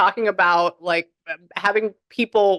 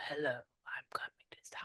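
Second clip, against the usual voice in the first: raspy whispered mob boss; Godfather voice; 4 semitones lower, 20 dB quieter than usual. Retold or imitated. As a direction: breathe it like an old mafia don. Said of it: "I was like the Godfather".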